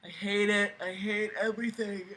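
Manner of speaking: normal talking voice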